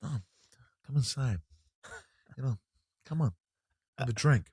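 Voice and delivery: hushed, halting celebrity impression; De Niro. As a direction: murmur it in short, grudging bursts. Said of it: "I can't do a De Niro".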